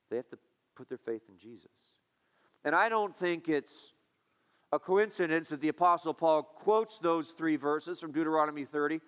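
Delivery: usual speaking voice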